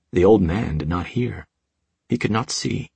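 plain reading voice

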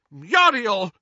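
high, loud protest; sudden scandalised cry; shocked exclamation. A narrator's reading. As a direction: exclaim the name in outrage. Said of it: "exclaimed Doge".

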